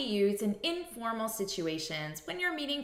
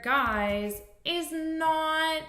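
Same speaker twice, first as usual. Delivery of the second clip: "guys" stretched slow and higher; drawn-out guys; 7 semitones higher, 4 dB louder, much slower than usual. Shown as a demonstration. Teacher stressing a word for learners, slow and high. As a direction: linger on "guys" slowly. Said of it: "notice here".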